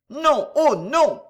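slow, emphatic protest; growled refusal. A narrator's reading. Bohemian woman refusing to see the bishop, growling. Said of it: "gronda la bohémienne".

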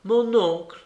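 put-on child working up to ask; with effort. A narrator's reading. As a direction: force out a timid request to an uncle. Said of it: "dis-je avec effort".

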